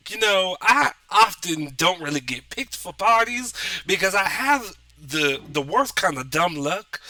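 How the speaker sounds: put-on a big-hearted Southern warrior with a Southern accent. GM voicing Jenny introducing herself to the party, Southern accent. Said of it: "she's got a southern accent".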